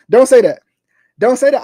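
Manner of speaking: loud, high, cut it off hard; emphatic outburst. Real, not acted. To doubting fans, a loud emphatic refusal. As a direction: shout it down, fed up for real. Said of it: "'cause I'm getting too emotional".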